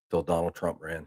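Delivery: ordinary talk, nothing marked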